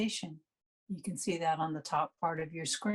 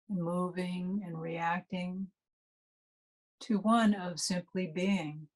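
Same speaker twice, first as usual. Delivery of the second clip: guided meditation. Real, not acted. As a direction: ease listeners unhurriedly into resting and noticing the breath.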